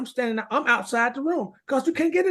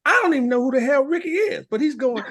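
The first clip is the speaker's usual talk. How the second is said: ordinary delivery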